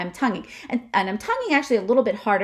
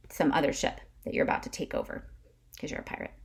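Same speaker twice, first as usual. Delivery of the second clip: whispered aside; 5 semitones lower, 8 dB quieter, much faster than usual. Real, not acted. Aside about pirates.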